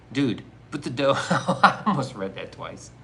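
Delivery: real laughter bubbling through the words; laughing. Real, not acted. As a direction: break into laughter mid-read. Nearly rereads a message, cracks up.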